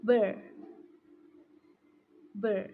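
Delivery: burr with a clear r; demonstrating sound. Shown as a demonstration. Student showing classmates the bor syllable. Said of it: "Listen, everyone".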